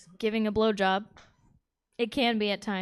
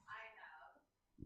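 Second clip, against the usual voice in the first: whispered admission; 3 semitones lower, 34 dB quieter than usual. Real, not acted. Audience member whispering answer.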